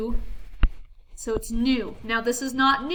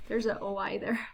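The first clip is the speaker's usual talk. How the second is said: "There's a O I there" whispered with no voice; 3 semitones lower, 7 dB quieter than usual. Real, not acted. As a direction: point it out under the breath. Whispered side remark.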